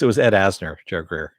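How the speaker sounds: ordinary talk, nothing marked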